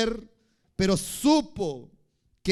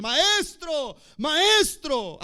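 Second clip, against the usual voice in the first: a higher, louder urgent call; crying out; 9 semitones higher, 7 dB louder, much slower than usual. Retold or imitated. Disciples calling out urgently to their master. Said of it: "Gritaban al Señor".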